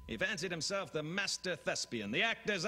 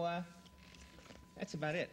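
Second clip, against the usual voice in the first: ordinary talk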